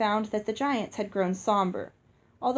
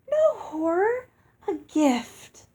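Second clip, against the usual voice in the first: much higher, light and sing-song; musical fairy voice; 9 semitones higher, 3 dB louder, slower than usual. A narrator's reading. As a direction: answer sweetly, proud of yourself.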